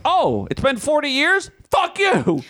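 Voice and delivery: your own body snapping back, pitched high; grumpy body voice. Retold or imitated. Body talking back to its owner, mock grumpy high voice. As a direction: play your aging body telling you off.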